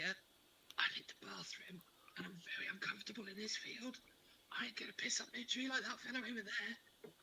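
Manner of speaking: hushed, embarrassed whisper of complaint. Acted. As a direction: keep it down so no one hears.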